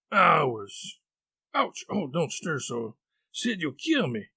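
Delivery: theatrical suffering; feigned agony. Acted. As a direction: wince and complain as if mortally hurt. Tom acting in agony.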